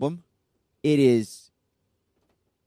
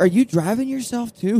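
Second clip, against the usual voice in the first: higher-pitched worried question; mock concern; 8 semitones higher than usual. Fan checking on the touring singer, mock worry. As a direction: imitate a fretting fan, amused. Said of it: "Genuine concern".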